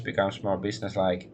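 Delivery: ordinary talk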